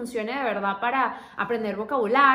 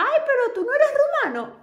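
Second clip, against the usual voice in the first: much higher and louder, astonished; amazed exclamation; 12 semitones higher, 6 dB louder than usual. Pretend. Locals amazed she is not Romanian, high surprised exclamation. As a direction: gasp it out like a stunned local.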